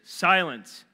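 loud and high command; commanding shout. Acted. Jesus rebuking the wind, a loud high command. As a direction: command the storm. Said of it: "rebuked the wind".

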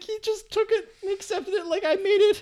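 high giggling outrage; Michael's laughing rant. Retold or imitated. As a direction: squeal it out through laughter. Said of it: "He's like".

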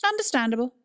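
curt, high, clipped reply; one word, cut short. Acted. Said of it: "responded in a clipped way".

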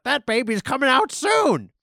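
put-on the fart announcing the baby, high and loud; talking fart.

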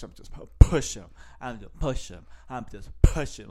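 hard popped P on "push"; explosive P on "push". Retold or imitated. Host imitating the comedian's emphasis on the push, exploding the P. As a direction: punch "push" with a burst of breath on the P. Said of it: "explodes the P there".

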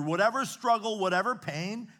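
building preacher's cry over the congregation; fervent preaching. Acted. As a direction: preach it out with conviction. Preaching at full force.

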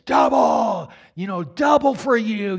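imitating a menacing shout, loud and drawn out; shouted threat. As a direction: hurl it as the wicked woman's threat. Wicked woman thrusts finger, threatens.

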